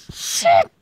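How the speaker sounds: high, loud shocked yelp; startled yelp. Real, not acted. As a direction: shriek it in surprise. Sudden yelp at game.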